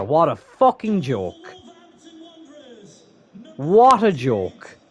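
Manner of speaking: fed-up streamer; disgusted. Real, not acted. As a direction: spit it out in disbelief. Disgusted after conceding.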